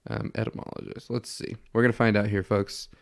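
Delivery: normal talking voice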